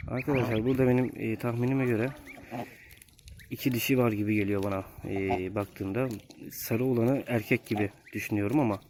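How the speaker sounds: ordinary talk, nothing marked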